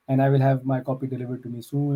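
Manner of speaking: ordinary talk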